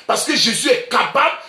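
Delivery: full-voiced preacher's proclamation; preaching shout. Real, not acted. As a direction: cry it out to the congregation.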